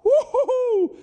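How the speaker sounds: squealing falsetto woo-hoo-hoo, laughing; a high comic yelp of doom; high mock alarm whoop. Preacher hearing his mum call his name, high whoop. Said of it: "that was like".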